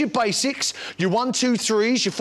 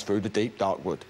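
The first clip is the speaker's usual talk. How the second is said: a dad imitating his little boy echoing the line, lower and softer; lower, softer toddler echo; 12 semitones lower, 5 dB quieter than usual. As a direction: echo it back like a small child, low and quiet. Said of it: "and he goes".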